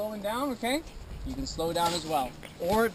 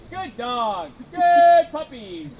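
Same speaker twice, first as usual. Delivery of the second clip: high, gushing praise for the dogs, drawn out slow; happy encouragement; 6 semitones higher, 11 dB louder, much slower than usual. Shown as a demonstration. Guide showing guests how to praise the dog team, high and happy. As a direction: cheer the dogs on like they're the best puppies. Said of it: "follow that hike command with".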